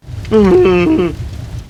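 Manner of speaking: a loud, strange, falling monster laugh; loud creature laugh. Acted. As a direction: let the creature bellow a laugh. Monster reacts to flames.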